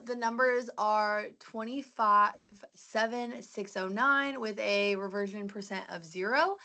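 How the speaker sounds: normal talking voice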